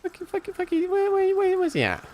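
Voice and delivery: high mock-panicked whine; squeaky frantic search. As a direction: squeak it out hunting for him. Searching for the enemy.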